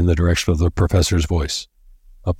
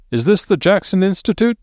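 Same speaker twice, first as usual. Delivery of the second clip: cheery; 13 semitones higher than usual. A narrator's reading. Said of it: "a cheery voice".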